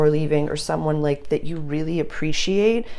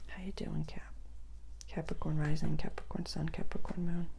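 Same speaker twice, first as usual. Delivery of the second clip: soft, near-whispered roll call; hushed greeting; 11 dB quieter than usual. Real, not acted. Reader turning to Capricorn to check in, hushed greeting. Softly summons the next sign.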